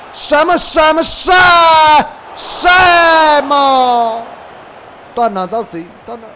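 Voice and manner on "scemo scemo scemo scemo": shouting at a teammate, much higher and louder; angry yelling. Real, not acted. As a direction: yell it out, furious at the player.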